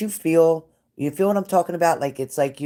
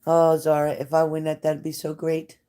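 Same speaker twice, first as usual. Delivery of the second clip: ordinary talk, nothing marked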